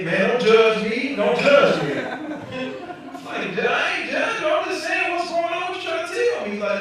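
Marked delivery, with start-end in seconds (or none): 0.0-1.9 slow, loud pleading; uncle's loud plea. Retold or imitated. 1.9-6.5 loud, higher mock yelling at uncle; incredulous shout. Comic yells at uncle.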